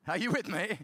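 usual speaking voice

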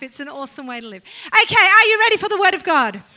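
normal talking voice

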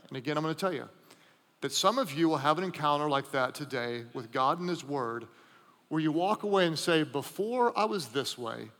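soft, low intimate sermon voice; hushed preaching. Acted. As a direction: draw them in quietly.

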